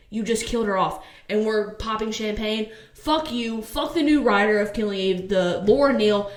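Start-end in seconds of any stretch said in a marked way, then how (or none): none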